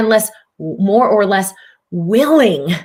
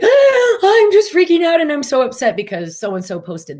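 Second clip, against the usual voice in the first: higher, louder, frantic imitation of an overwhelmed friend; 9 semitones higher, 5 dB louder than usual. Imitating an overwhelmed friend upset over a post, high frantic. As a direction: gush out the upset in a rush. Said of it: "every little thing ... immensely overwhelming".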